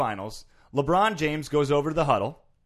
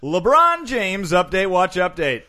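stretched-out segment announcer, louder; hyped segment intro for the listeners; 4 semitones higher, 6 dB louder, slower than usual. Acted. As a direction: call out the segment title slowly.

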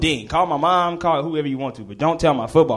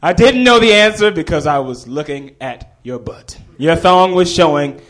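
loud, bold public declaration; full gumption; 3 semitones higher, 6 dB louder than usual. Retold or imitated. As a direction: stand up and announce it brazenly. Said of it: "stood up with all the gumption".

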